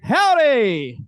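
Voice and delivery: big booming western howdy; loud howdy. Real, not acted. Host booming a howdy to the crowd. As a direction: greet the whole room loudly, cowboy style.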